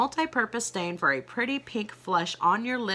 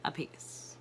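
whispered; 13 dB quieter than usual. Real, not acted. Echoing price in a whisper.

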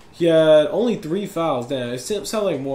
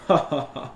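short laugh; 2 semitones lower, 3 dB quieter than usual. Real, not acted. Gamer reacting to opponent pausing, short laugh.

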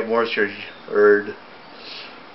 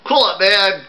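yell; 9 semitones higher, 4 dB louder, slower than usual. A narrator's reading. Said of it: "he yelled".

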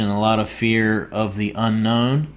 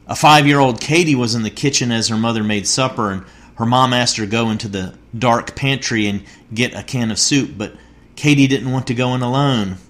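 normal talking voice

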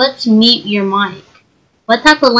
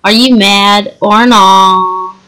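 taunting; 3 semitones lower, much slower than usual. Acted. Slow taunting question.

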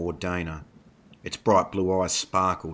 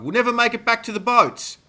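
loud, very high despairing shout; panicked cry; 6 dB louder than usual. A narrator's reading. Marco despairs while fleeing the wolves, panicked cry. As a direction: cry out that all is lost. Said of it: "Marco cried".

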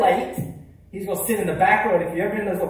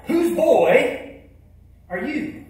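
louder and higher, pressing the question; even louder; 7 semitones higher, 5 dB louder, much slower than usual. Retold or imitated. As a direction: boom it out louder across the church. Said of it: "he said it even, and even louder".

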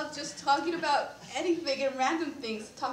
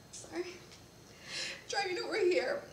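tearful; 5 semitones higher, 4 dB quieter than usual. Real, not acted. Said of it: "I'm sorry I'm crying".

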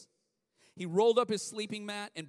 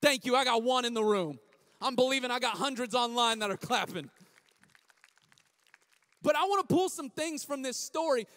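rallying crowd; 4 semitones higher, 5 dB louder than usual. Real, not acted. Hyping the congregation.

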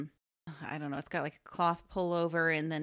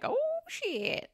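very high drawn-out squeal; hammed-up high-pitched exclamation; mock flustered reaction; 3 dB louder than usual. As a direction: imitate a bashful swoon in falsetto. Acts out turtle's flustered reaction.

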